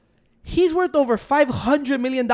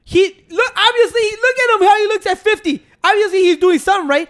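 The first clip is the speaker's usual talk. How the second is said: excited; 8 semitones higher, 5 dB louder than usual. Real, not acted. Excited praise of Joe Rogan.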